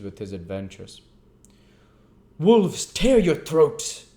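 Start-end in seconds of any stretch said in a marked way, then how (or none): 2.4-4.1 slow, high strained snarl; muttered curse. Acted. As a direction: curse through clenched teeth. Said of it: "muttered Sikes, grinding his teeth".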